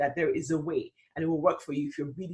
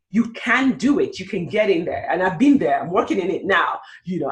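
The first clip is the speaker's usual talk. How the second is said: usual speaking voice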